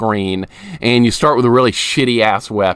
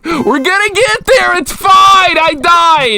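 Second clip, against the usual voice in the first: forced cheer; 6 dB louder than usual. Real, not acted. Forced cheer after death.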